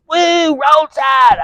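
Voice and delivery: very high, drawn-out mock fan squeal, slow; drunk fan cheer. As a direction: stretch the cheer out like a tipsy heckler. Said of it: "And she goes".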